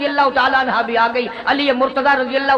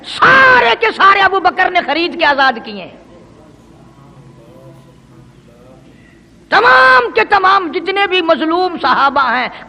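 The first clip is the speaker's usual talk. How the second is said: impassioned preaching; 3 semitones higher, 4 dB louder than usual. Acted. Preacher praising Abu Bakr's sacrifice to congregation, impassioned. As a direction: hit the first word hard, then descend.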